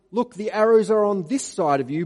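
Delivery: loud called signal, rising on arrows. Retold or imitated. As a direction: call it across the field to the boy. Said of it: "If I say to him".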